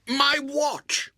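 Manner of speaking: high, loud furious cry; a boy outraged at his loss; furious shout. Acted. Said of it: "almost inarticulate with fury".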